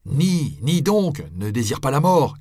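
high, loud plea; urgent pleading cry. A narrator's reading. People on the road beg the friar to deny, loud. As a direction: beg him to recant.

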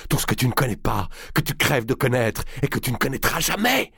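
hâtif, à bout de souffle; voix pressée, haletante. Acted. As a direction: parler vite, le souffle court. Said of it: "d'une voix pressée, haletante". (haletant et pressé)

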